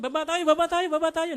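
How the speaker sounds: high, loud, hurried repeated cry; acted panic. People in a building fleeing a tsunami, in mock panic. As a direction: urge everyone out in a mock panic.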